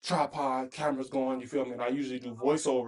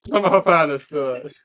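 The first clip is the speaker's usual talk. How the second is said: laughing; 11 dB louder than usual. Real, not acted. Laughing at girlfriend's advice.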